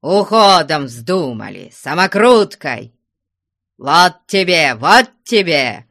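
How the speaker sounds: loud, emphatic rebuke; scolding shouts. A narrator's reading. Father lashing the eloped couple, loud angry scolding. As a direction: punch each phrase like a blow. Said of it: "кричал Потап Максимыч".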